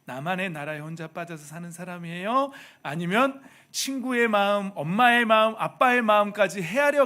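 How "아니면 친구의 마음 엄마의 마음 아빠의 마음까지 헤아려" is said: louder, higher climactic preaching; preaching at full force. Real, not acted. Pastor urging the children to read parents' hearts, full-force preaching. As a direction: drive the point home from the pulpit.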